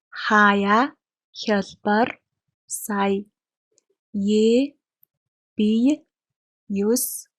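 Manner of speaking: each ya-diphthong word said slowly and distinctly; clear ya-diphthong drill. Shown as a demonstration. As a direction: model each word so learners can repeat it. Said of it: "Listen and repeat".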